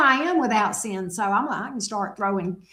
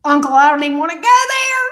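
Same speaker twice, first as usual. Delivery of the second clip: fast, high, louder pet voice; voicing the pet; 4 semitones higher, 9 dB louder, faster than usual. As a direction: talk for the pet as if it sasses back. Voicing the dog talking back.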